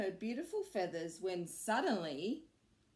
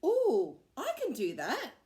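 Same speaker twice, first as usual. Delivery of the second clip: eager, higher and louder; a rabbit who can't wait to try; excited; 6 semitones higher, 6 dB louder than usual. A narrator's reading. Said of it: "said Rupert excitedly".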